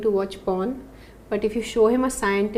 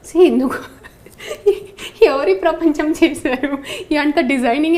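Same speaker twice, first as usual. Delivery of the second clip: bursts into real laughter; amused disbelieving laugh; 4 semitones higher, 6 dB louder, much faster than usual. Real, not acted. Laughs at absurd design of body.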